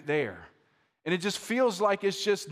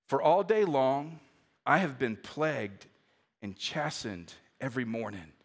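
slow scripture reading; 6 semitones lower than usual. Shown as a demonstration. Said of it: "I'm gonna read it slowly".

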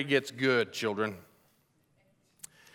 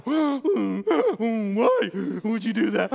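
slow, higher braying donkey voice; talking donkey impression; 4 semitones higher, much slower than usual. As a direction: bray the words between gasps, hammed-up. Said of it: "wondered what that sounded like".

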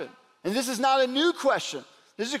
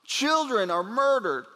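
usual speaking voice

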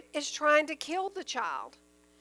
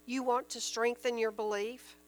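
ordinary delivery

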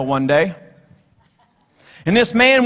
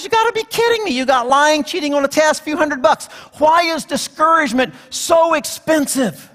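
higher, unbelieving shopper voice; incredulous customer; 7 semitones higher than usual. Retold or imitated. Man at garage sale protests the price to Satan, higher and incredulous. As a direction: protest the price like a stunned bargain hunter. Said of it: "The guy goes".

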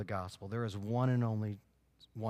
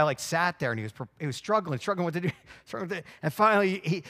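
ordinary talk

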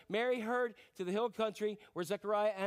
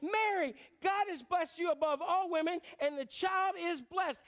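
joyful exclamation; 8 semitones higher than usual. Acted. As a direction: exclaim the blessing with delight. Elizabeth exclaims in joy.

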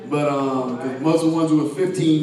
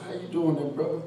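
deep greeting; 3 semitones lower, 9 dB quieter, faster than usual. Retold or imitated. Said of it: "And she".

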